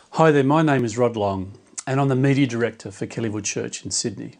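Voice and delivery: ordinary talk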